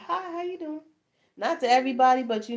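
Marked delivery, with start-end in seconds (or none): 0.1-0.8 friendly greeting. Retold or imitated. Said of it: "I'm automatically gonna say".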